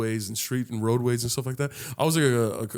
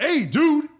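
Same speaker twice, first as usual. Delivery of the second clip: raised-pitch scolding, acted out; indignant confrontation; 9 semitones higher than usual. Bystander defending the worker from a rude customer, indignant. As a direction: step in and tell him off, heated.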